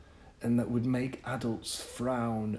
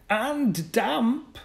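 put-on boy's report; 6 dB louder than usual. Boy voiced in story.

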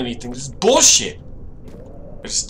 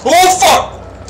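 high loud startled curse; startled yell; 10 dB louder than usual. Real, not acted. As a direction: yelp in real shock. Startled by paper.

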